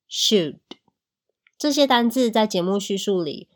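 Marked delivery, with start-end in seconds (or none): 0.1-0.6 should with no L sound; silent L. Shown as a demonstration. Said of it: "L不读出来的单字还有". (should的L不读出来)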